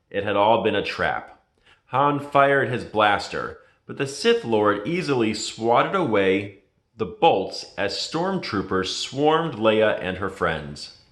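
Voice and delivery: usual speaking voice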